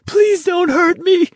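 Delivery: very high, piercing plea; panicked falsetto shriek; terrified scream. A narrator's reading. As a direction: scream and beg in terror. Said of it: "someone screamed".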